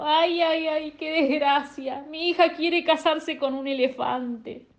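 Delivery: high, very slow wailing mother; whimpering lament. Acted. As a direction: moan it for the whole crowd to hear. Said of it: "se alejó lloriqueando". (lamento lloriqueado y lento)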